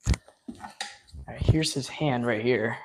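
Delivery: ordinary talk, nothing marked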